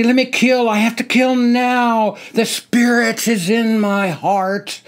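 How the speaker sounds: high, loud manic scream. Acted. Soldier faking madness in line, screaming. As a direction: yell it like you're crazed. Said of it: "I screamed".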